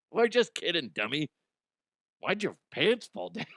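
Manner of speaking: ordinary talk, nothing marked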